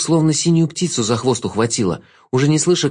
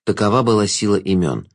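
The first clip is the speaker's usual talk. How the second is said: ordinary delivery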